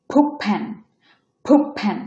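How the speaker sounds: ফুট said "pup" before ফ্যান; assimilated T. Shown as a demonstration. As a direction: turn the t ending ফুট into p. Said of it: "এটা উচ্চারণ হবে".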